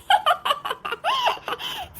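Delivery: high rising scornful laugh; mocking laugh. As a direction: laugh off her threat. Daughter-in-law mocks threat.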